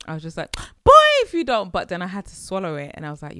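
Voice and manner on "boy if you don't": loud, high mock threat; indignant outburst. Mum reacting to her son's complaint, loud indignant outburst. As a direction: explode in mock outrage, much louder and higher.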